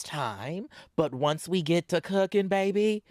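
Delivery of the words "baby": usual speaking voice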